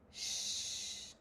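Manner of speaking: long, soft shushing hiss; hushing "shh". Shown as a demonstration. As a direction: shush someone as a one-line stage role. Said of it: "That was my line".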